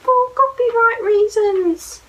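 high, loud, slow mock announcement; playful answer. Real, not acted. Parent explaining to her son why the story stops, playful. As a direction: sing it out like a punchline. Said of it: "Why are we gonna stop there".

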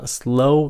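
"Slow" high, with a rising tune; stressed slow. Shown as a demonstration. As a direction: push "Slow" upward. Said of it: "nhấn âm nó".

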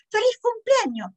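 high, excited birthday cheer; happy greeting. A narrator's reading. Dog greeting the birthday child, high excited cheer.

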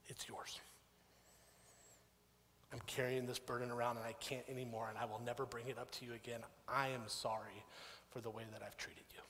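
soft, humbled confession; hushed, heartfelt retold apology; sincere apology. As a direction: lay the burden down quietly.

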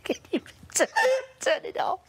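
high, breathy, laughing plea; breathless laughing. Real, not acted. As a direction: wheeze it out, can't stop laughing. Asking through laughter.

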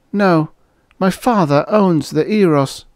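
high-pitched female character; young woman's voice. Acted. Waitress explains her father owns the hotel, girl's voice. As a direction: answer him in a high girl's voice. Girl explains why she stays.

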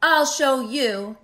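high, loud, drawn-out threat; indignant mock screech; grouchy screech. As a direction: holler it defiantly, stretching each word. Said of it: "screeched the grouchy ladybug".

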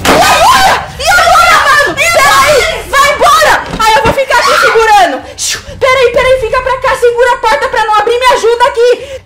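frantic yelling, high and loud; panicked shouting. Real, not acted. Panicked holding door shut.

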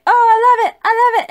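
high, loud squeal of delight; gushing delight. Retold or imitated. Friend squealing over the ending, high and loud. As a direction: gush like a thrilled fan. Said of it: "She'll say".

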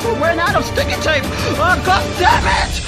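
high exasperated outburst; desperate, fed-up yell; frustrated. Acted. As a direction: build to a shouted curse. Guard's repair plan fails.

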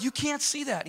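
can't believe it; disbelief. Retold or imitated. He tells his buddy in high disbelief. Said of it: "And I'm like".

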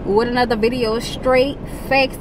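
high, bright greeting energy; hyped vlog intro. Acted. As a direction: pump up the intro like a YouTuber.

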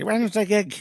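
loud, high mock Belfast voice; bad Belfast accent. Comedian grandad answering his granddaughter, bad Belfast accent. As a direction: throw it out in a badly faked Belfast accent. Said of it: "that was my bad Belfast accent there".

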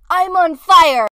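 loud, drawn-out exclamation; acted shout. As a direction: shout it slowly, stretching the words. Retake of exclaimed line.

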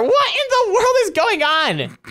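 high, drawn-out outburst; exasperated bafflement. Pretend. Seeker loses Cam in the castle, baffled high outburst. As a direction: stretch each word high in disbelief.

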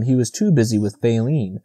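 ordinary delivery, nothing marked

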